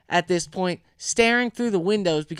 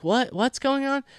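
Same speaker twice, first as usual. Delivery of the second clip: higher-pitched puzzled questions; baffled; 7 semitones higher than usual. Retold or imitated. Friend answering a stranger's odd call, baffled. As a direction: retell your confusion, pitch lifted.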